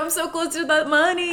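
high, mock-greedy woman's voice; a put-on high voice counting the money; greedy fiancée. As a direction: gloat like she's eyeing the cash.

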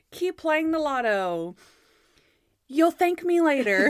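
loud, high, stretched TV sign-off; hammed-up catchphrase delivery; [name]. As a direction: badly act a lottery host's farewell. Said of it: "Bad [name] acting is so funny".

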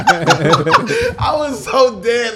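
slow laugh-filled words; laughing through it. Real, not acted. Laughing after story.

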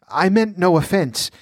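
high, placating plea; nervous, appeasing priest; trembling apology. A narrator's reading. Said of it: "The high priest was trembling".

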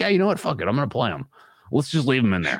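mock coach voice pitched well up; Staley. Podcaster mimics the coach shrugging off a risky call, high put-on voice. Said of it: "Was that your Staley voice?".

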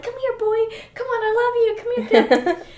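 high sweet coaxing call to a dog; sweetest calling voice. Retold or imitated. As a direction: coax the dog in, pitch way up and sweet. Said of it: "nicest voice ever".

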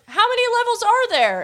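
high, eager puzzled question; mock-innocent high voice; Supreme Kai voice. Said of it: "Supreme Kai goes".

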